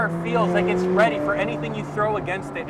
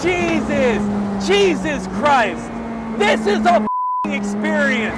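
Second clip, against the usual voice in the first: louder, overwhelmed exclamations; real thrilled shouting in the car; real excited yelling; 2 semitones higher, 5 dB louder, slower than usual. Real, not acted. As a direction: yell out the awe. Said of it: "I'm just yelling a bunch of nonsense now".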